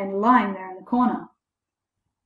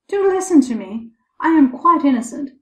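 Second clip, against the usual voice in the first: higher, louder plea; pleading protest; 6 semitones higher, 6 dB louder than usual. A narrator's reading. Boy to his scolding father, pleading. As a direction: protest innocence earnestly.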